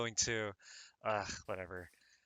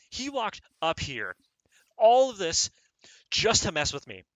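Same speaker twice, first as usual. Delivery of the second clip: loud, high, disbelieving rant; exasperated rant; 5 semitones higher, 7 dB louder than usual. Real, not acted. Scolding the game villager in his bed, loud and exasperated. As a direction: rage at the villager who took your bed.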